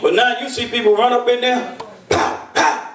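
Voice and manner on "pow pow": whispered pow pow; breathy gunshot sounds under his breath; imitated gunshots.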